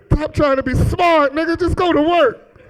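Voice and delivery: high-pitched comic telling-off; fed-up, high-pitched orders; exasperated scolding. Retold or imitated. Scolding the homeboy over Oops.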